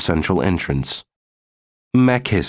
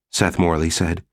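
usual speaking voice

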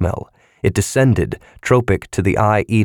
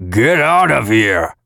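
irritated, louder growl; angry mutter; 4 semitones higher, 4 dB louder, slower than usual. A narrator's reading. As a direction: shove the words out angrily. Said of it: "muttered angrily".

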